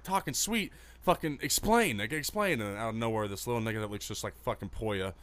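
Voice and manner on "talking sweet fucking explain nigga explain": high, loud retold demand; heated, incredulous retort; retold angry comeback. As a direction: act out challenging him right back. Retells his own angry comeback.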